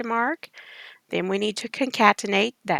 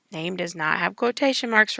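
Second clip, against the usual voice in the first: usual speaking voice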